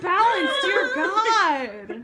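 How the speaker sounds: laughing and shouting in disbelief; laughing exasperation. Real, not acted. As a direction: plead through laughter, exasperated.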